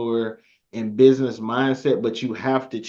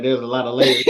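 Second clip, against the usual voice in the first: laughing catchphrase. Retold or imitated. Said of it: "one of the words I always say".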